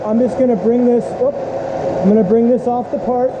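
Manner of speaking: shouting over machinery. Real, not acted. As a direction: call out above the running mill. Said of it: "I'll be yelling over the machine".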